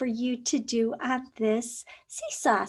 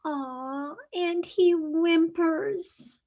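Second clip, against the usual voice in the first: usual speaking voice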